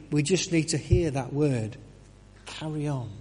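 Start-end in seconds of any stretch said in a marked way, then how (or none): 2.5-3.1 soft, hushed carry on; whispered encouragement from God; gentle whisper. Acted. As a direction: breathe it like God's whisper.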